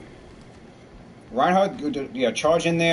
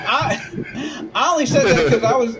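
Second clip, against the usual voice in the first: high, loud flustered excuse; defensive protest; 8 semitones higher, 3 dB louder than usual. Real, not acted. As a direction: protest it, pitch shooting up. Defending himself, voice jumps high.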